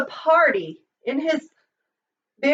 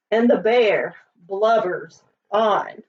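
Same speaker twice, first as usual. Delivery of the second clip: ordinary delivery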